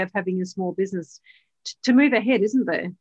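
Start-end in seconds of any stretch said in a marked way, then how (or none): none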